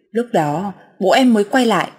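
plain reading voice

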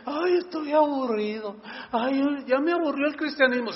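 high, whiny mock complaint; a hammed-up sigh of boredom. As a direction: whine like a bored churchgoer. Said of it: "el cristiano que dice".

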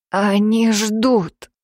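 slow, strained warning; tense strained voice. Acted. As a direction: push it out tensely. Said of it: "его голос стал низким и напряжённым". (напряжённо, медленно)

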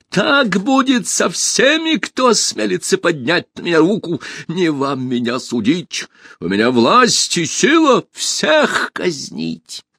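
high, drawn-out frenzied threat; enraged shouting. A narrator's reading. Usurper threatening the whole hall, frenzied shouting. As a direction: rant in a high, raving voice. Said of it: "закричал в бешенстве узурпатор".

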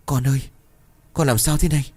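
put-on high, distressed plea to her child; tearful mother. A narrator's reading. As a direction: voice a worried mother on the verge of tears. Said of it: "nước mắt bấy giờ liền ứa ra".

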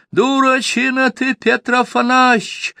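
very high, loud shrill yell across the tavern; piercing thin yell. A narrator's reading. Peasant yells at Petr across the tavern, piercing and thin. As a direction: screech it so the whole tavern hears. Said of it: "заорал на весь кабак пронзительно тонким голосом".

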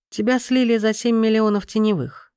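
a light mocking tone; лёгкая насмешка. A narrator's reading. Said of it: "лёгкой насмешкой". (с лёгкой насмешкой)

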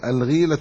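الغيلة said "al-gheela", long ee after gh; الغيلة with kasra, as al-ghīla; kasra on the ghayn. Shown as a demonstration. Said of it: "بكسر الغين".

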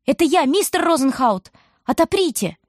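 high pleading call through the storm; girl shouts at locked door. A narrator's reading. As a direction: call to be let in. Girl calling at shop door.

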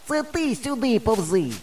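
high, slow drawn-out retort; calling back insistently. A narrator's reading. Boy tells friend to crawl over, insistent call. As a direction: insist from a distance. Boys squabble across the cornfield.

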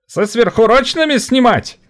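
high, biting sarcasm; caustic. A narrator's reading. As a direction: needle him. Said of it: "едко отозвался". (едко)

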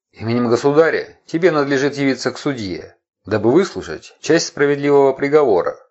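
loud, high haughty proclamation; haughty herald. A narrator's reading. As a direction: announce it from above like a herald. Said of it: "надменный голос глашатая".

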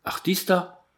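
high, drawn-out scornful word; scornful, pitched well up; contemptuous. A narrator's reading. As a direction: toss it out with contempt. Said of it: "d'un ton méprisant".

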